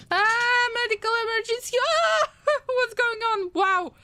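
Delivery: high, fast panicked yelps; a real high-pitched panic with a laugh; panicked alarm. Real, not acted. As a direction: squeal at the sudden emergency. Sudden medical emergency in game.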